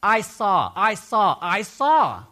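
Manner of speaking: preacher driving the point home to the congregation, loudly; emphatic declaring. Shown as a demonstration. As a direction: hammer home each "I saw" like a witness. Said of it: "this is what you're gonna hear".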